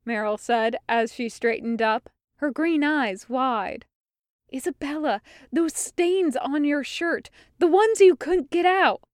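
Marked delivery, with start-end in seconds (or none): 4.5-9.0 higher, building urgency; urgent warning. A narrator's reading. Meryl warning Isabella about her stained shirt, urgent and rising.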